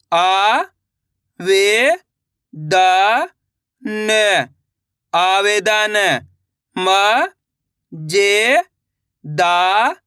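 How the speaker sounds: slow, separate syllables, then the word drawn out; patient teacher dictating syllables; syllable by syllable reading. Shown as a demonstration. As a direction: spell each sound for beginners, then blend.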